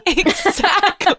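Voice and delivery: laughing high through the word Exactly. Real, not acted. Friend agreeing mid-laugh, laughing.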